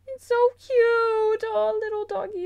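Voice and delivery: high, slow cutesy coo. Real, not acted. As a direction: coo over the puppy. Cooing at cute toy dog.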